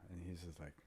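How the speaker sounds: usual speaking voice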